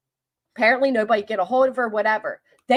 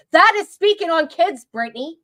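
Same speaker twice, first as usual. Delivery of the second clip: angry outburst; 6 semitones higher, 4 dB louder than usual. Real, not acted. Angry rant at Brittany.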